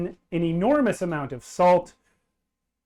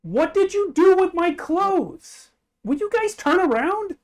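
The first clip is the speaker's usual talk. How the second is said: indignant outcry; 13 semitones higher, 5 dB louder than usual. Acted. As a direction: shriek it, mortified and accusing. Naked Beck demands clothes and privacy.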